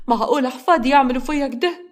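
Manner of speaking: mock-tearful grandmother whines يعملوا up high; fake sob. Grandmother complains of grandchildren, fake tearful rise. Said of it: "جليلة بتمثل العياط".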